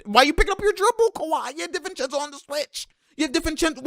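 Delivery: high-pitched disbelieving complaint; squeaky exasperation; exasperated. Real, not acted. Pleading with player over bad decision.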